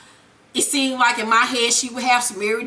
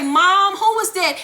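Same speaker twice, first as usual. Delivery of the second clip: put-on high, raised kid-like call; son calling Mom; 6 semitones higher, 3 dB louder than usual. Tariq asking his mother about the visitor, son's voice. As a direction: call out higher and louder, imitating her son.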